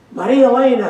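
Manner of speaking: calls the name out high and loud; proudly calling her name to the crowd; excited announcement. Retold or imitated. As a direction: announce the winner with a flourish. Announcing the first loan recipient.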